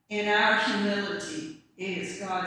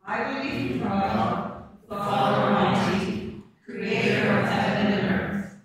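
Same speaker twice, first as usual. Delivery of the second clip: louder, steady chanted recitation; communal creed recitation; 3 semitones lower, 4 dB louder than usual. Real, not acted. Whole congregation standing to recite the Apostles' Creed, chanted in unison. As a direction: recite the creed together in measured rhythm.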